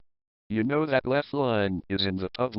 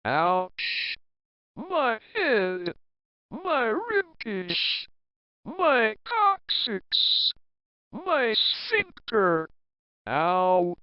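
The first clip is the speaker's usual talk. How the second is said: in pain; 10 semitones higher, much slower than usual. Acted. Hurt after ladder fall.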